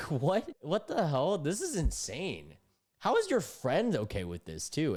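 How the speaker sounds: high, loud what-the-hell disbelief. Real, not acted. Streamer reacts to her payment demands, loud high disbelief. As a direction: let the shock climb up high.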